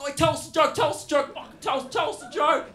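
put-on high, loud nagging kid voice; a bogan kid yelling for a joke; pestering kid. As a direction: badger the teacher like a cheeky teen.

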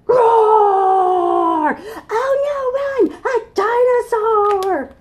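high, loud playful screaming; mock panic. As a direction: shriek like scared grown-ups. Frightened people flee dinosaur.

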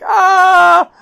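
sudden high, loud shriek of horror; shocked scream. Retold or imitated. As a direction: shriek like the bear just appeared. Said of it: "and I'm like".

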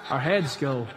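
normal talking voice

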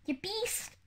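high-pitched cry without much volume; understated story-reader's shout; mild angry shout. A narrator's reading. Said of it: "Mister Nye shouted".

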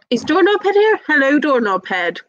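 high-pitched call that eases down into a greeting; high, questioning hail. Real, not acted. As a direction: call out to spot someone in the crowd, voice lifting high. Greeting a viewer by name.

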